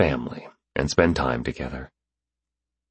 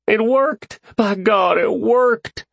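much higher, louder cry of joy; overjoyed shout of triumph; choked but exhilarated; 4 dB louder, much slower than usual. Acted. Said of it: "His voice was choked but exhilarated".

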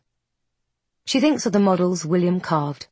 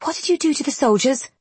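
put-on young woman voice; 8 semitones higher, faster than usual. A narrator's reading. Isby voiced, stunned question.

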